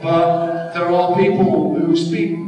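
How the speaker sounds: high, drawn-out, affected posh voice; strangled posh voice. Retold or imitated. Actor defending his invented voice to the director, strangled and posh. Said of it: "So I came up with a voice".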